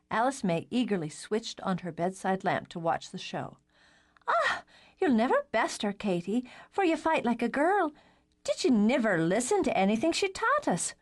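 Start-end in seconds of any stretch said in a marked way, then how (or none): 4.3-10.9 put-on high, girlish voice; light teen girl voice; little sister voice. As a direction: play the cheeky youngest sister. Alice May taunts Katie.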